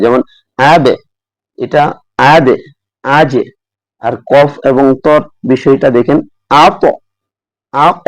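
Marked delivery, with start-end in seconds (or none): 0.0-3.4 আবে with an echo that drops downward; light, falling rebound on আবে and আজে; low qalqalah demo. Shown as a demonstration. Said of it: "জিমের কলকলাটা নিচের দিকে যাবে". 6.7-8.0 আপ with a heavy echo that rises upward; thick, upward-bouncing আপ; rising qalqalah demo. Shown as a demonstration. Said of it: "উপর দিকে গেল".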